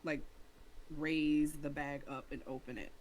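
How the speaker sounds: normal talking voice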